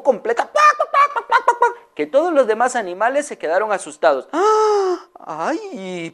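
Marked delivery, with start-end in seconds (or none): 4.3-6.1 high squeal of alarm; high frightened yelp; startled animal cry. Acted. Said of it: "pensaron los animales".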